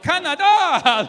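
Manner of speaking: high, loud and slow shout; mock stage shout; drawn-out stage shout. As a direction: stretch it out for the crowd. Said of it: "He's like".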